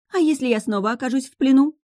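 high-pitched, worried question; doubtful. A narrator's reading. Witch fearing capture, doubtful. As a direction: voice your misgivings. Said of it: "сомневалась ведьма".

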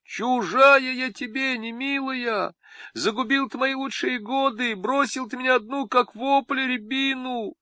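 high melodramatic village wail; wailing lament. Acted. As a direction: wail out her grievances. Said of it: "издав тяжёлый пронзительный рёв".

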